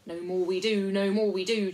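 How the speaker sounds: loud, eager echo of agreement; hearty, forceful group agreement; chorus chiming in. A narrator's reading. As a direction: chime in like a crowd of followers. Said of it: "chimed in the others".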